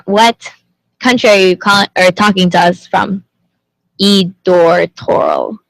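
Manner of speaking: ordinary delivery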